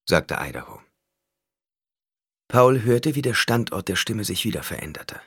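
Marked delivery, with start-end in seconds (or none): none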